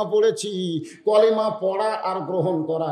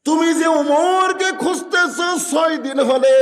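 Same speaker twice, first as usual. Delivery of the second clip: high, loud acted outcry; impassioned cry; 9 semitones higher, 6 dB louder than usual. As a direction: cry it out as the moved caliph. Omar cries out to the girl. (আবেগে উঁচু গলায়)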